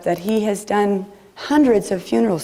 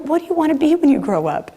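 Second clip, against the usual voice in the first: put-on higher, faster and pushier, pressing the child; insistent repeat; 8 semitones higher, faster than usual. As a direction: press the question again, impatient. Adults insisting on the question.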